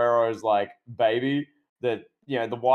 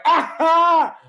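sudden high, loud mock scream; high mock yell; 8 dB louder than usual. Dominic reacting to the revelation, hammed-up shriek. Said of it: "and Dominic's just like".

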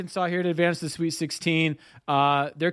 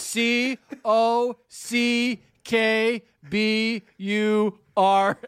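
very slow, higher-pitched letter-by-letter spelling; spelling bee contestant; 4 semitones higher, much slower than usual. Pretend. Contestant answers in a mock spelling bee, slow deliberate spelling. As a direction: spell it out one drawn-out letter at a time. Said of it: "you're up in the spelling bee".

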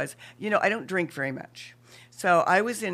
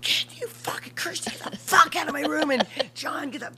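higher hammed-up rant; mock furious yell; 9 semitones higher than usual. Imagined woman in labor ordering everyone out, mock angry. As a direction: pretend to throw everyone out. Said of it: "I would have gone".

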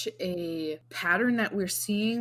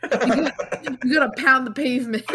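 loud, fast, through laughter; laughing; 3 semitones higher, 5 dB louder than usual. Real, not acted. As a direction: laugh the words out. Laughing mid-sentence.

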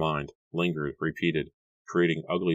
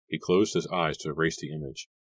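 ordinary delivery

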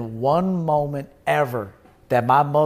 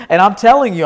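raised, pushing voice to make the point; higher and louder, driving it home; louder insistence; 6 semitones higher, 9 dB louder than usual. Real, not acted. Insisting to his viewers, raised and emphatic.

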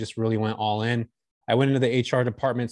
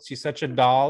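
normal talking voice

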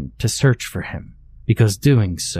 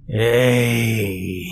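animalistic call. Acted. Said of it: "his animalistic call".

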